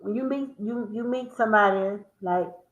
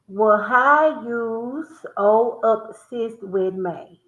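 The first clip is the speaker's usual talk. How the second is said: slow, drawn-out country accent; much slower than usual. Shown as a demonstration. Admitting a country accent to viewers, slow. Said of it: "saying these words country".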